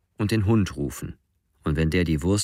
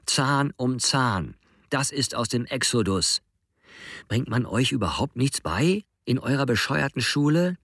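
angry Joas; 6 semitones higher than usual. A narrator's reading. Joas keeps shouting.

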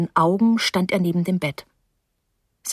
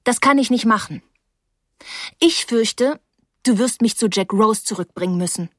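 firm, understated resolve; 3 semitones higher than usual. A narrator's reading. She insists Terrapin drive her back, firm. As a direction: state it decisively, no wavering. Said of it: "mit sehr fester Stimme".

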